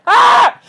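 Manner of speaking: high, loud mock scream of despair; frustrated scream. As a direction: let out a hammed-up yell of frustration.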